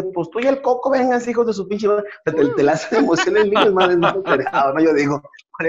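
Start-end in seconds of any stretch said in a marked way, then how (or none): 0.0-2.0 put-on defiant kid. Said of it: "Le dice".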